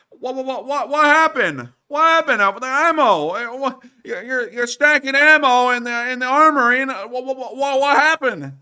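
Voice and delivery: high, loud, stammering comic voice; mock bewildered outburst. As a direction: stutter in hammed-up, squawking disbelief. Mock bewildered outburst about missing ammo.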